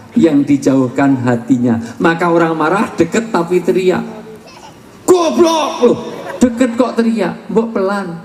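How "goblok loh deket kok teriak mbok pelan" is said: mock angry scolding. Mocking an angry shouter.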